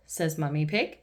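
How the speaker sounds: usual speaking voice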